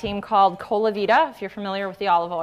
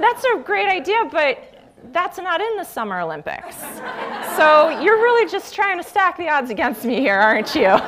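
laughing while teasing back; laughing retort; 6 semitones higher than usual. Retold or imitated. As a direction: tease them through laughter. Her retorting reply, laughing.

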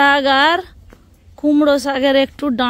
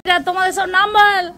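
raised, high voice for a deaf grandma; to someone hard of hearing; 6 semitones higher than usual. Real, not acted. ঠাকুমা তো কানে শুনতেই পাবেনি.

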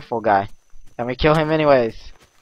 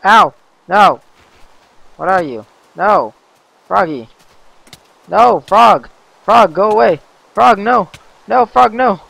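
loud, high panicked yells at the frog; panicked yelling; 8 semitones higher, 12 dB louder than usual. Real, not acted. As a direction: shout in panic as it attacks.

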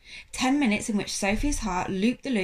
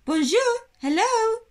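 high, loud calls into an empty shop; calling out; 11 semitones higher, 8 dB louder, slower than usual. A narrator's reading. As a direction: call out each hello into the silence. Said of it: "she called".

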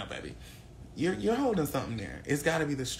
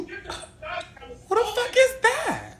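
high, slow, outraged; shocked drawl; 5 dB louder, much slower than usual. Pretend. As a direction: stretch it out in mock shock. Mock outrage, dragged out.

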